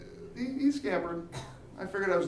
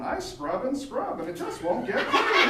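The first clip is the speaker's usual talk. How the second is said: higher mock infomercial whine; infomercial pitch; 8 semitones higher than usual. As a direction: whine it like a late-night ad. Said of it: "some infomercial going".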